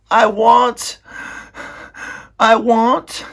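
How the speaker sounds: high, loud, crying; high sobbing wail. Acted. Meg sobbing to the princess, high and loud. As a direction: wail it through tears.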